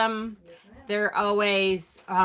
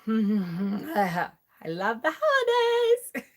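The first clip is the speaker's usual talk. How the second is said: slow, drawn-out sarcastic delight with laughter; mock festive cheer; much slower than usual. Host venting about family Christmas, mock festive cheer. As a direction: stretch out the fake holiday joy.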